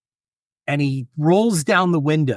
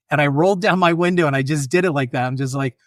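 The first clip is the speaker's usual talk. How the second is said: usual speaking voice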